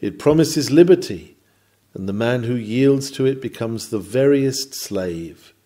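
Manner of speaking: ordinary delivery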